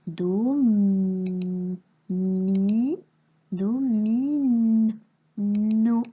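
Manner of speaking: "m" and "n" held long before "mi" and "no"; sounds told apart. Shown as a demonstration. Teacher helping a pupil tell m from n, held consonants. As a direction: sustain each consonant, then open into the vowel. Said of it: "attention à bien entendre le m et le ne".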